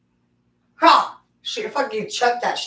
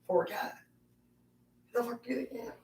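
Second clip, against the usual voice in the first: whisper; 4 semitones higher, 10 dB quieter, much faster than usual. Real, not acted. Mock outrage to dog.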